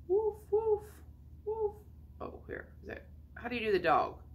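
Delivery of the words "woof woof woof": put-on high playful woof for a toddler; high dog bark. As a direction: yap like a little cartoon dog. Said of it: "There's a dog".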